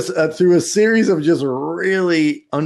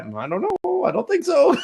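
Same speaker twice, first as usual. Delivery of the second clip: mock doubtful sister; 13 semitones higher than usual. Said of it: "my sister's like".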